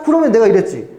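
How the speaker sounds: ordinary delivery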